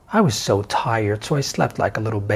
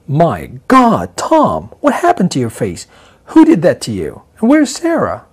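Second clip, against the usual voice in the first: loud shocked cry, pitch shooting higher at the end; gasping alarm at his battered face; shouting questions at a beaten friend in shock; 5 semitones higher, 8 dB louder than usual. Acted. Finding Tom's beaten face.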